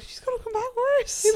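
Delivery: very high, drawn-out squeal; mock private dread. Friend privately doubting the trip will help, mock-dread squeal. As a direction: squeal the secret thought, comically.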